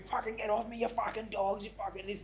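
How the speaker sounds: retold screaming. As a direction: imitate a man screaming while pinned down. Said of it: "He's on the floor screaming".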